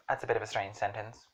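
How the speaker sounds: ordinary talk, nothing marked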